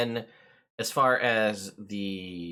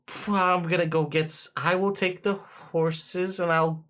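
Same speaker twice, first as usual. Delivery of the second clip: higher, halting servant voice; uneasy footman; 6 semitones higher than usual. Acted. Footman faced with an owl bear, politely uneasy. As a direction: stammer politely through the nerves.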